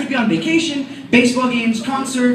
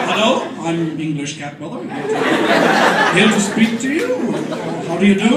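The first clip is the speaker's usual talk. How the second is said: lower, hammed-up posh English voice through laughter; posh English cat; 6 semitones lower than usual. Garfield in a monocle greeting you, hammed-up posh English voice. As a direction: play a fancy cat in a top hat.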